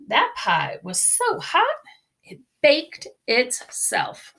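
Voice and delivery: usual speaking voice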